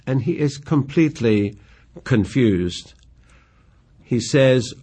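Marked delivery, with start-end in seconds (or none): none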